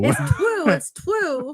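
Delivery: "true" said as "twoo"; baby-talk r. Pretend. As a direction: say "true" with a w for the r, playfully. Echoing a comic mispronunciation of true.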